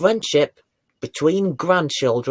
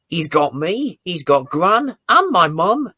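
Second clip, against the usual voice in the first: ordinary delivery, nothing marked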